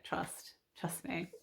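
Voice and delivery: quiet sincere trust me; soft aside. Real, not acted. Vlogger assuring viewers softly, quiet. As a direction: drop to a near whisper.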